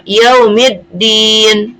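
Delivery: slow "yaumiddin", no l, mim going straight into a doubled d; merged lam. Shown as a demonstration. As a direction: recite "yaumiddin" drawn out, the lam melted into the d. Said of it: "Tidak dibaca jelas atau dileburkan".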